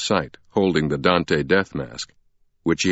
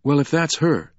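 higher-pitched character voice; Sienna; 9 semitones higher than usual. Acted. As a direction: answer him in a raised pitch. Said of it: "Sienna said".

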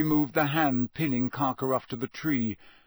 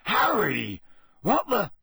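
high, breaking-off startled cry; 7 semitones higher, 4 dB louder, slower than usual. A narrator's reading. Hagrid seeing Harry in the forest, startled. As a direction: blurt it out in alarm. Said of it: "his eyes widening".

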